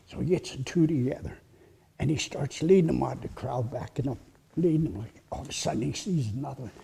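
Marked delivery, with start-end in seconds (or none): none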